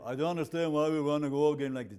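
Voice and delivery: put-on German accent. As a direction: lightly imitate a blunt German. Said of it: "with a much more accent than I'm saying it now".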